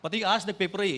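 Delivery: ordinary delivery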